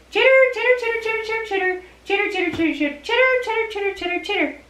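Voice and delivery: long run of high squeaky chitters; chittering puppet. Pretend. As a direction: chatter on and on like a critter puppet. Angel puppet long speech.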